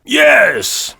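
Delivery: booming, mocking tyrant; drunken king crowing over his joke; loud jeering king. A narrator's reading. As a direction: taunt the dwarf loudly. King voiced in story.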